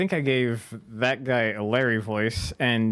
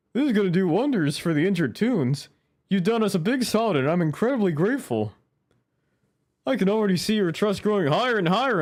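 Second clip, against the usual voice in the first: higher, eager game character; cheerful character voice; 8 semitones higher, 4 dB louder than usual. Acted. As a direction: read it as an upbeat helper. Reading game NPC dialogue.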